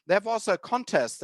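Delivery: usual speaking voice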